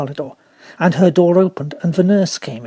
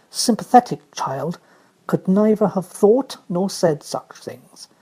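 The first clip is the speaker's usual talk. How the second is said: usual speaking voice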